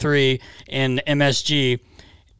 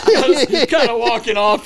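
laughing; 9 semitones higher, 6 dB louder, slower than usual. Real, not acted.

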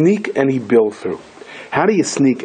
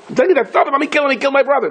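high-pitched urgent pleading, at normal volume; agitated insistence; 13 semitones higher, much faster than usual. Acted. Security guard urges the king to let him take revenge, agitated. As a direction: press the king in a high, worked-up voice.

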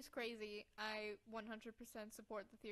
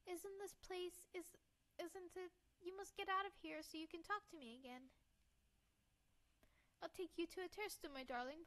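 put-on higher-pitched, earnest girl voice; tender young heroine; 6 semitones higher than usual. Girl promises her cat they will stay together, tender.

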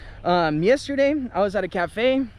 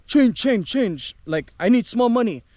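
mock pushy customer barking for change, a bit louder; curt demand; 4 semitones higher, 4 dB louder than usual. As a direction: repeat it impatiently, rudely. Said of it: "And he's like".

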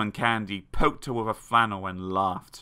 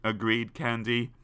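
usual speaking voice

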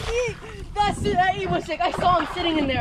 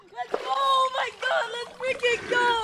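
high, slow cheering; excited cheer; 8 semitones higher, slower than usual. Real, not acted. Friend celebrating the snook, cheering high and drawn out.

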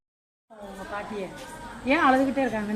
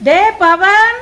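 loud, high call for someone far away; 6 semitones higher, 8 dB louder, much slower than usual. Acted. Aunt summons the playing boy, calling out loudly. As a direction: holler the name, higher and stretched.

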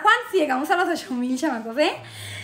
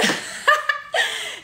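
high laughing fit; burst of laughter; 11 semitones higher than usual. Real, not acted. Storyteller cracking up after a joke, high laughter. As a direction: crack up at your own joke.

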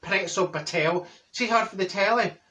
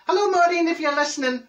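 high, louder call to a friend; 10 semitones higher, 4 dB louder than usual. Acted. Calling out to friend.

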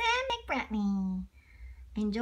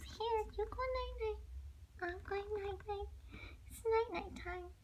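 much softer, higher hush; soft bedtime voice; 11 semitones higher, 12 dB quieter than usual. Acted. Dad settling little bunny to sleep, very soft. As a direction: tuck him in gently.

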